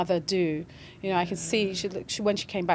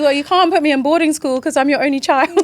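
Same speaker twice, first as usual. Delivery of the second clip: quoting her son through laughter, pitched up and louder; laughing while quoting; 7 semitones higher, 9 dB louder than usual. Retold or imitated. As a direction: laugh through the son's line. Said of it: "He was like".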